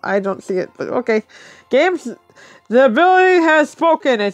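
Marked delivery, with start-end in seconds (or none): 1.7-4.2 higher, louder grand pronouncement; raised, emphatic mock decree; mock proclamation. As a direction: declare it like a solemn verdict. Mock proclamation of the game's verdict.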